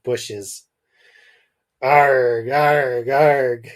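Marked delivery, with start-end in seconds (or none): 1.8-3.7 loud arg cries from afar; distant yelling. A narrator's reading. Richard yelling up from the river below, loud cries. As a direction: bellow it up from the river. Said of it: "came Richard's voice from the river".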